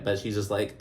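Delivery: ordinary delivery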